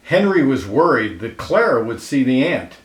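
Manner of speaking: ordinary delivery, nothing marked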